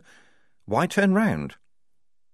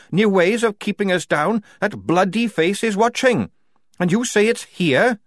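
loud, higher heated tirade; red-faced anger; 10 semitones higher, 5 dB louder than usual. A narrator's reading. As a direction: hammer out every grievance. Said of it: "red in the face".

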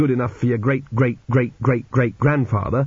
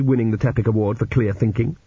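ordinary delivery

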